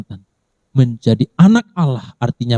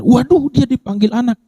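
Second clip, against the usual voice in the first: mock shocked bystander; 11 semitones higher than usual.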